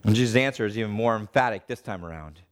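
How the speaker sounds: usual speaking voice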